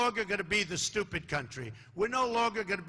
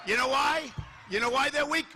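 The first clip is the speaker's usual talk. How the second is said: rally taunt; 5 semitones higher than usual. Real, not acted. Taunting crowd about weak protesters.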